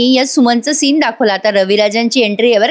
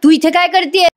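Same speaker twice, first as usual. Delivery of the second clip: sharp, high angry question; the husband catches his stepdaughter inside the house, furious; angry outburst; 8 semitones higher than usual. Retold or imitated. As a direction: snap at her in sudden fury. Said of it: "एवढे चिडतात ना".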